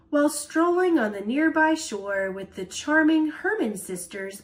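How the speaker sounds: ordinary delivery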